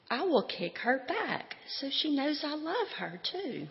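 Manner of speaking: put-on high, lifted voice of an eager child; excited. A narrator's reading. Unborn Molly answers God about her mother, excitedly. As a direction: burst out with eager delight. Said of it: "Molly said excitedly".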